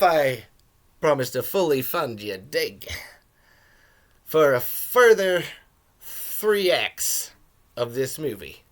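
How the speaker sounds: put-on loose Australian accent drifting toward South African; Australian-ish character voice. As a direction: put on a rough Australian accent with drawn-out words. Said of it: "It's a little bit of an Australian accent".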